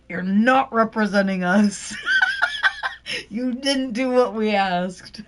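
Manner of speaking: real laughter breaking up the quote; laughing retelling. Retold or imitated. Host retelling the Thing firing his lawyer, laughing. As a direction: crack up while quoting the rebuke.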